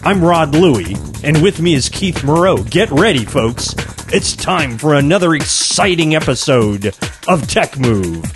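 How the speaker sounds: radio show intro read; upbeat broadcast opener; announcer voice. Acted. Podcast opener over music.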